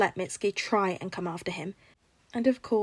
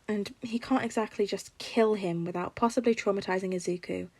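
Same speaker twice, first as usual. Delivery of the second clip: ordinary delivery, nothing marked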